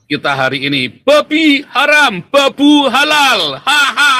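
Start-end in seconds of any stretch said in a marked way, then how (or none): 1.0-3.6 high, slow, gloating villain voice; sneering panto villain. Pretend. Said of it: "tertawa jahat". 3.6-4.1 high, loud villain cackle; evil laugh. Pretend. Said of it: "tertawa jahat".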